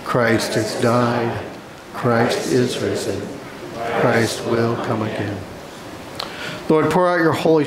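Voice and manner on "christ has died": usual speaking voice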